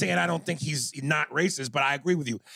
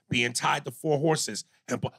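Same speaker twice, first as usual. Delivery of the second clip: ordinary talk, nothing marked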